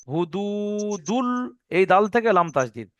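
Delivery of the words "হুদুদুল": slow Quranic drawl on হুদুদুল with a heavy full L; drawn-out recitation. Shown as a demonstration. Teacher demonstrating to students, slow chanted হুদুদুল. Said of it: "দেখেন".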